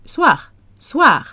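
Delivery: "Soir" short and high, "swahr"; quick, high French "Soir" with a brief R. Shown as a demonstration. Demonstrates shorter, lighter soir.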